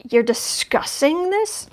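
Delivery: slow, disbelieving question climbing high at the end; drawn-out, high-rising incredulity; incredulous. Acted. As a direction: stretch it out in disbelief, pitch rising sharply. Incredulous at people arguing the obvious.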